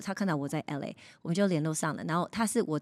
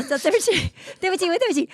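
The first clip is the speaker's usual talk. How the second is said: higher, louder, giggling sorry; laughing apology; 11 semitones higher, 6 dB louder than usual. Real, not acted. Guest suddenly remembers a story, laughing apologetically. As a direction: apologise through real laughter.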